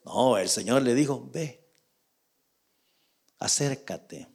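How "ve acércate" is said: soft, low divine instruction; low and much softer; quiet urging. Acted. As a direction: gently coax someone forward in a hushed voice. God coaxing David in acted exchange.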